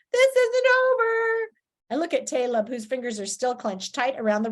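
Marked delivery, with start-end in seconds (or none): 0.1-1.5 high, loud, slow parting shout. A narrator's reading. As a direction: yell it back over the shoulder. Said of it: "shouting over his shoulder".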